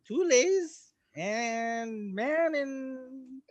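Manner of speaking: ordinary talk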